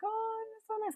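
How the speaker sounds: very high, soft swoon; dreamy delight. Retold or imitated. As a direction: melt over a sweet daydream. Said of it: "And then I'd be like".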